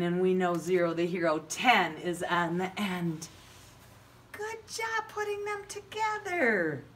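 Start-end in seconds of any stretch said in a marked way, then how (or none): 4.3-6.8 very high squeaky praise; high puppet voice. Acted.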